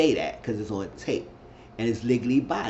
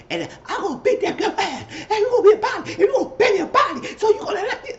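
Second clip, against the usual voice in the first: very high, loud squealing laughter through the words; laughing squeal; 6 dB louder, faster than usual. Retold or imitated. Mocking Jen's threat to beat the girl, laughing high squeal. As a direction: shriek it out while cracking up. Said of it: "I was like".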